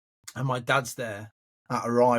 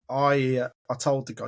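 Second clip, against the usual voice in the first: normal talking voice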